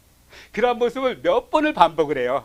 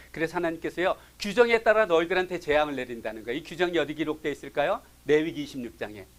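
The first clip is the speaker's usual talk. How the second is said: ordinary delivery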